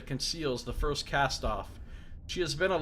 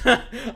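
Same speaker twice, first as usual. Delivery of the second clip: laughing; 10 semitones higher, 10 dB louder than usual. Real, not acted. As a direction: burst of real laughter. Laughs at the nickname.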